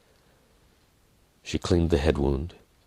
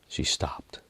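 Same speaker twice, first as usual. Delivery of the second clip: whispered; 8 dB quieter than usual. A narrator's reading. Quiet desperation.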